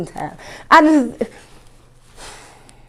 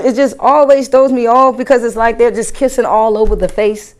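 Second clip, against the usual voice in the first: usual speaking voice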